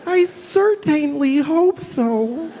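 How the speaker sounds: high, slow, sweet and naive; mock the innocent twenty-year-old; naive young self. Imitating his naive younger self worrying about dogs. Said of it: "When I was, like, twenty".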